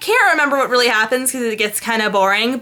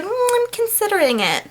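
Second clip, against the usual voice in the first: high, dreamy mock consideration; much higher girly hum, mocking her; mock dreamy Anna; 10 semitones higher than usual. Anna mulling the controlling contract, high dreamy mock. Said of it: "she's just like".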